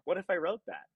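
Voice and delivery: tickled by a sudden idea; retold amused laugh. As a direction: act out cracking up at your own idea, voice lifting. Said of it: "thought that made me laugh".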